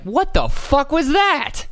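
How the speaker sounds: high-pitched, indignant disbelief; incredulous outburst. Acted. Reacting to the congressman's gotcha, high incredulous outburst. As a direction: shoot way up in pitch, appalled. Outburst mocking the questioner's sudden switch.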